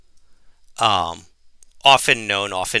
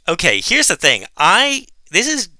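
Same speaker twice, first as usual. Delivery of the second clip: stubborn insistence; 6 semitones higher than usual. Retold or imitated. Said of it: "And I'm like".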